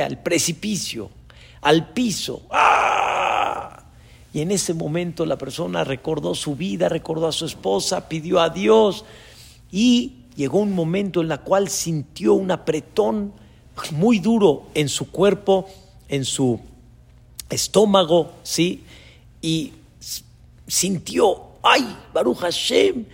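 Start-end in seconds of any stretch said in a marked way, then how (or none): 2.5-3.8 drawn-out scream of a falling man; long hammed-up "aah" on the way down. Falling climber's cry, acted. 21.6-23.1 higher, crying out in relief; climber's exclamation of relief; acted relief. Climber's relief as rope holds.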